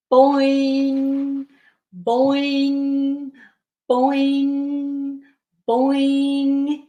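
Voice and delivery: springy monotone boing with ringing end; trampoline boings. Acted. Bear jumps on the trampoline, springy boings. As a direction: bounce each word and hold the hum.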